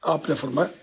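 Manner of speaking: usual speaking voice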